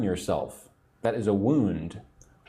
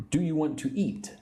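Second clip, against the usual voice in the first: usual speaking voice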